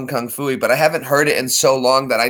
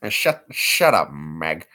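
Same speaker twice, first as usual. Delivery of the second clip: Peter Griffin; 8 semitones lower, slower than usual. Shown as a demonstration. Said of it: "Do the voice of".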